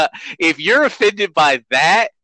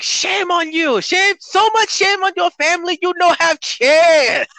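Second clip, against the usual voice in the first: Chang impression; 4 dB louder than usual. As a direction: squeal it like the Hangover's Chang. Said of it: "the Chang voice".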